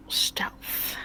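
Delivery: breathy stealth whisper. Pretend. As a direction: whisper it like you're sneaking. Sneaking for a stealth roll.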